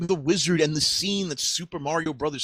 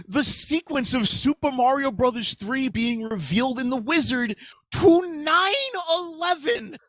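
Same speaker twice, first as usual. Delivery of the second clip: lightly hammed-up high-pitched outrage; a touch of theatrical flabbergasted protest; hammy stage actor; 10 semitones higher than usual. Exaggerated indignant outrage.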